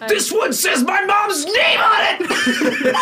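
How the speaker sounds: laughing mock glee. Mocking kid opening gifts.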